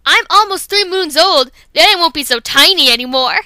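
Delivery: a little kit bragging about his age; Otterkit eager. Acted. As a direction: boast about growing up. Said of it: "here's his lines".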